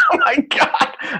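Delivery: high laughing outburst. Real, not acted. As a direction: laugh through it, slowly. Cracking up at the tease.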